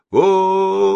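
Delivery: long, high, loud wail; sustained high-pitched hail; calling out. A narrator's reading. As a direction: call across a distance, stretching the vowels. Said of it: "позвал Геннадий".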